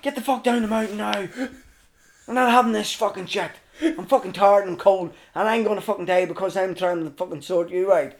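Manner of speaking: high-pitched, exasperated tirade; retold angry rant. Tired rescuer ordering climber down, angry rant. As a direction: lay into him, fed up and insistent.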